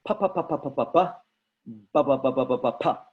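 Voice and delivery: crisp popping pa and ba syllables; a teacher's plosive warm-up, pa pa pa then ba ba ba; plosive drill. Shown as a demonstration. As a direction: pop each "pa" and "ba" off the lips. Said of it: "I want you to try and say this".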